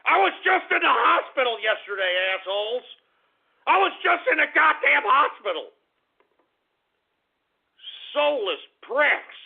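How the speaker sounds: high, loud furious shouting; furious yell. Real, not acted. Host rants at callers about the hospital, yelling furiously.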